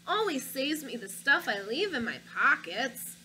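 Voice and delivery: put-on lively read-aloud for children; story narration. A narrator's reading. Reading a picture book to children, lively storybook narration. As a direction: tell it like a picture book to kids.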